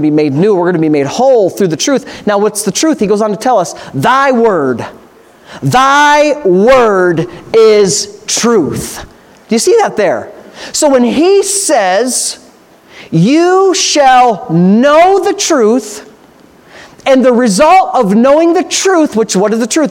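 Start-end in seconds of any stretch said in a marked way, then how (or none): 3.9-9.0 extremely slow, word by word; deliberate scripture quotation. Real, not acted. Preacher answering what truth is, slow and deliberate. Repeats the verse for emphasis. 13.1-16.1 very slow, sustained words; deliberate scripture quotation. Real, not acted. Preacher quoting what he says, slow and deliberate. Quotes Jesus to the congregation.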